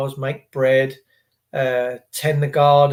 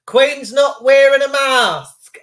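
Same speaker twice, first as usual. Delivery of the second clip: slow, higher mock outrage; reading out a comment; 8 semitones higher, 6 dB louder, slower than usual. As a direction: read the comment like a scandalised gossip. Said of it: "Emma Forbes says".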